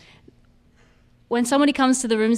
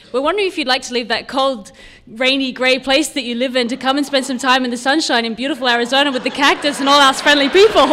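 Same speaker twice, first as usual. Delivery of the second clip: louder and rising, breaking into laughter at the end; playful over-the-top invitation building to a laugh; gushing fantasy invite; 4 semitones higher, 7 dB louder than usual. Retold or imitated. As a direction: sell the sunny Arizona dream, getting louder and giggling. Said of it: "But what I heard was".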